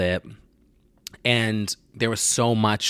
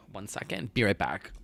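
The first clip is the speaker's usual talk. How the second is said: soft breathy whisper; whispered aside; 8 dB quieter than usual. Real, not acted. Stepping away from the camera, whispering. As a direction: breathe it out as you step away.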